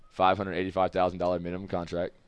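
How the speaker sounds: ordinary talk, nothing marked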